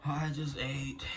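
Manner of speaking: ordinary talk, nothing marked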